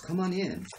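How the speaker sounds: high, lightly teasing taunt; mock-sweet invitation. Woman taunts rival while climbing the stairs, high mock-sweet invitation. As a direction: lift the pitch into a catty come-hither.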